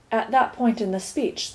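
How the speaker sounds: ordinary delivery, nothing marked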